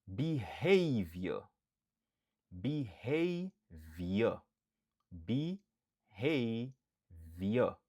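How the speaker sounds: Behavior slow with HAV stressed; careful slow teacher model; slow model word. Shown as a demonstration. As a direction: drawl the word slowly, stress the middle syllable. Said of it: "Stress syllable lan, li sou dezyèm silab lan".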